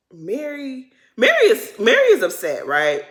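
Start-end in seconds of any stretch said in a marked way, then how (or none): 1.2-2.7 laughing through the words; real laughter breaking the line. Real, not acted. Host cracking up at Mary's reaction, laughing. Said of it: "I start laughing".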